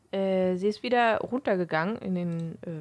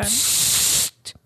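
shushing; 4 semitones higher, 3 dB louder than usual. Acted. Librarian shushes students.

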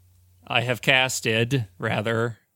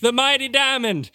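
fake deacon preaching; 12 semitones higher than usual. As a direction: proclaim it to the faithful.

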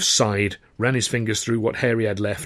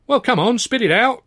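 high-pitched, testy prodding; harassed impatience; 12 semitones higher, 3 dB louder than usual. A narrator's reading. Harassed older chap prompts fumbling youth, impatient. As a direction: hurry him along as a harassed older man. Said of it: "the harassed older chap said".

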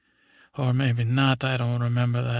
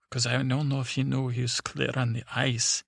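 normal talking voice

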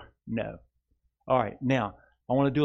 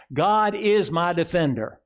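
slow, higher and louder declaration; slow, raised proclamation; 5 semitones higher, 5 dB louder than usual. Shown as a demonstration. Pastor leading the congregation in a slow proclamation. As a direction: ring it out slowly to the church. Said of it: "Say this with me".

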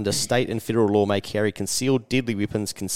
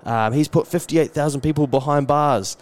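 higher-pitched, gushing praise; enthusiastic taxi driver; 5 semitones higher than usual. Retold or imitated. Salvadoran cab driver praising his president, enthusiastic. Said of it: "a taxi driver from L A".

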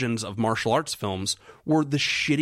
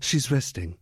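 bad acting. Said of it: "I'm a terrible actor".

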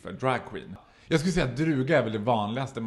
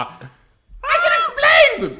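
very high, loud mock scream; panikskrik; 9 dB louder, slower than usual. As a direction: shriek the excuse in hammed-up panic. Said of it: "Jag skriker, pappan skriker". (gällt, spelat panikskrik)

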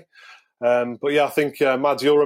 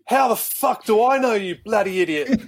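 mock exasperated Matty; 8 semitones higher than usual. Coach mocking a player's question in the video session, high put-on exasperated voice. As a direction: ham up the coach's fed-up outburst. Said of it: "Matty just went".

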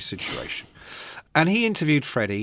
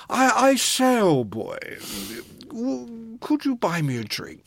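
mock upper-class voice, starting higher; hammed-up plummy old boy; posh Sid impression; 9 semitones higher, slower than usual. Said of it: "and he goes".

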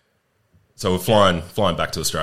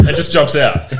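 ordinary talk, nothing marked

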